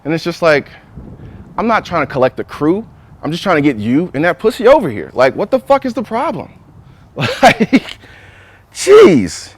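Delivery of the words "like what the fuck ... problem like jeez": exasperated outburst. Real, not acted. Exasperated rant at flaky friends.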